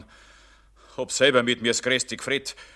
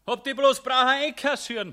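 shrieking quarrel; 6 dB louder than usual. Acted. Mammon and Jedermann quarrel.

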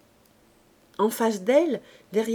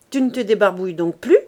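louder, fast mean sneer; nasty jibe; 8 dB louder, faster than usual. A narrator's reading. Man sneering at his partner's looks, louder and mean. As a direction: needle her cruelly. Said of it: "il ajouta méchamment".

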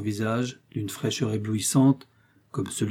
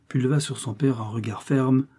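plain reading voice

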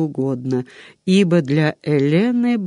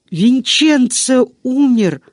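slow, loud, higher, anguished cry of grief; 8 semitones higher, 5 dB louder, slower than usual. A narrator's reading. Elena cries out that Vincenzo is dead, anguished. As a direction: wail the name out in despair.

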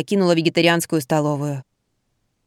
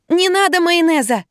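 wailing; 10 semitones higher than usual. A narrator's reading. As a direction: wail to stop the sauce. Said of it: "завопила я".

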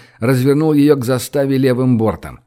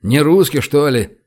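usual speaking voice

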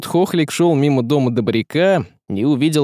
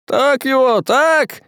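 egging on; 14 semitones higher, slower than usual. A narrator's reading. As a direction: egg on the fight. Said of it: "подначил кто-то".

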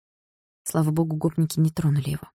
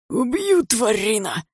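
hissing; 7 semitones higher, much slower than usual. Acted. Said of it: "зашипел тот".

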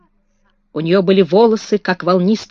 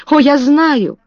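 raised, anxious exclamation; exclaiming; 5 semitones higher, 4 dB louder than usual. Acted. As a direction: cry it out in alarm, a bit higher. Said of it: "воскликнула она".

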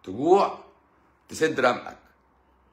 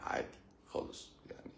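whisper; 17 dB quieter than usual. Real, not acted. Voice drops to a whisper.

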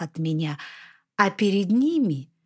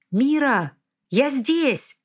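calling out; 5 semitones higher, slower than usual. Acted. Calling out across battle.

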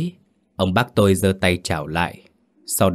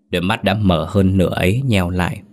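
ordinary delivery

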